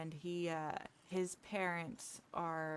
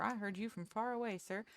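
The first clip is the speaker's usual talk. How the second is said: ordinary talk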